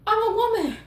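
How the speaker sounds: high, emphatic I'm a woman; insistent woman. Acted. Reader voicing a Gemini woman declaring her needs, high.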